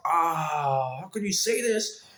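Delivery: high, whiny mock hurt; a hammed-up stepsister, hurt and pleading; mock upset stepsister. Stepsister protesting the remark, in a high mock whine. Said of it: "She was like".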